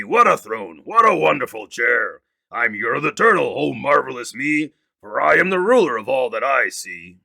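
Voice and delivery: smug turtle king crowing about his kingdom; boastful king Yertle. Acted. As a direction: gloat over everything you can see.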